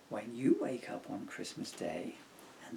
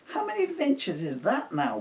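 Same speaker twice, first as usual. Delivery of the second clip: put-on girl voice; 10 semitones higher, 6 dB louder, faster than usual. Emily asks Bill.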